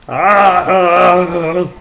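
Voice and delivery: long, high, loud "ah"; loud cry. Pretend. Vlogger crying out to the camera, loud long wail. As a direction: let out a drawn-out wail.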